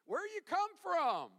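playful Southern twang on the question; southern accent. Acted. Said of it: "I like to put a little southern accent in there".

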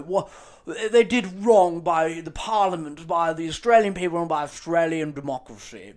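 mocking journalist. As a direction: parrot the journalist with a sneer. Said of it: "journalists who say shit like".

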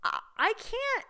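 high, cracking, laughing overwhelm; high, laughing overwhelmed cry. Retold or imitated. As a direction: squeak it out, laughing in disbelief. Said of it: "I'm like".

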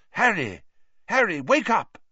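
high, loud urgent calls; urgent shouting. A narrator's reading. Lupin rousing Harry from a faint, urgent shout. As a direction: shout to wake him. Teacher rouses fainted boy.

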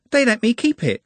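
put-on high-pitched, sheepish girl's voice; nervous, giggly. A narrator's reading. Juliet bashfully owns up to keeping the beard, nervous. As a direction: shyly admit it, high and nervous. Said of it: "with a nervous giggle".

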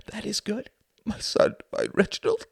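high, breath-broken motherly praise; tearful mother. A narrator's reading. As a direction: praise him through choked-up emotion. Distraught mother praises her son.

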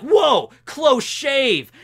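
high, very drawn-out quip; hammed-up pun. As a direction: stretch each word for the cheesy joke. Acts out Zoro's dodge pun.

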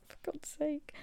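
high, whiny frustrated curse; exasperated. Retold or imitated. Told no food again, a high whiny curse. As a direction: throw your hands up.

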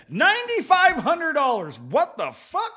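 high, loud disbelieving outburst; outraged shock. Real, not acted. Guy telling friends about a huge dental bill, loud and stunned. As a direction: blurt the price in stunned outrage.

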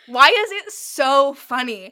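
slow, loud laughing question. Real, not acted. Laughing at the book.

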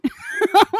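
high, loud burst of laughter; a sudden high laugh at the silly killer. Real, not acted. Loud laugh at own story.

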